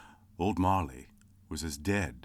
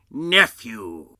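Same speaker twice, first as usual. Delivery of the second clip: loud stern retort; sternly; 5 semitones higher, 12 dB louder than usual. Acted. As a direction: shut him down firmly. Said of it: "returned the uncle sternly".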